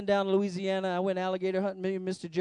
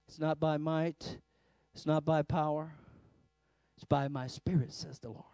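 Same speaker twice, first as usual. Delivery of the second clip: hushed scripture; 4 semitones lower, 6 dB quieter than usual. Real, not acted. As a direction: drop to a hushed awe. Quiet scripture after shouting.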